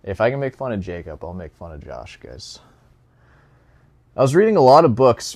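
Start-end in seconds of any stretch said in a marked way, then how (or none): 4.2-5.3 mocking read. Mocking reading of comment.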